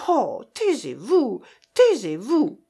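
slow, emphatic plea with swooping pitch; distressed, drawn-out hushing; pleading protest. A narrator's reading. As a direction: beg him to stop, stretching each word. Annette begs him to stop.

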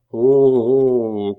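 long, loud, drawn-out "oh" of despair; despairing moan.

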